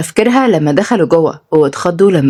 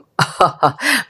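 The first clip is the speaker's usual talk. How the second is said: light acted chuckle; soft run of ha-has; teasing laugh; 4 dB quieter than usual. Son laughs at worried father.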